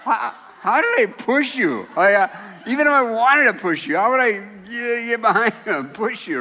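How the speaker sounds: loud, high, laughing protest; incredulous laughing. Retold or imitated. Grandpa defending himself to his grandson, loud laughing protest. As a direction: protest through laughter, amused and baffled. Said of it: "And I'm like".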